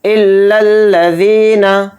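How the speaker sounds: इल्लल्लज़ीना with held double ll and stretched ज़ी; इल्लल्लज़ीना chanted slowly; doubled l's and long ee. Shown as a demonstration. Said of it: "ज़ी को थोड़ा खींच कर पढ़ेंगे".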